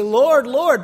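imitated pleading cry; mock loud plea. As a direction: call out Lord, Lord like a pleading crowd. Quoting false believers calling out.

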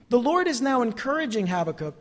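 ordinary delivery

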